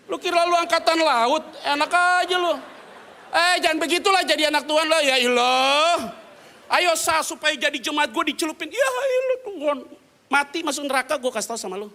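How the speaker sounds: loud, high scolding rant; high, loud mock scolding of a congregant; harsh mock preacher. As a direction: bark it out like a pushy preacher.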